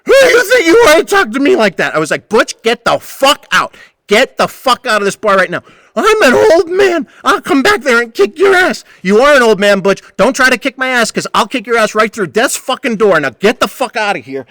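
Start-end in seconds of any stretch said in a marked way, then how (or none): 0.0-1.9 much higher, louder mock old-man shriek; hammed-up outraged screech; screeching Butch. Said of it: "starts screaming at me". 2.3-5.6 retold angry yell; yelling him out. Narrator yells Butch out. 6.0-8.8 much higher, louder mock old-man screech; hammed-up screeching old man; shrieking old man. Butch's threat, voiced. 9.0-14.4 loud, higher shouted comeback; retold angry shout; yelling back. Narrator threatens Butch back.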